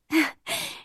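a brief acted laugh; short laugh. A narrator's reading. Sara delighted by the surprise cake, laughing briefly. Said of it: "She laughed".

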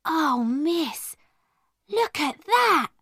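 high, wide-swooping delight; delighted amazement. A narrator's reading. Becky seeing the cake come out, amazed and delighted. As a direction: marvel at it with a rising swoop. Servant girl sees the cake.

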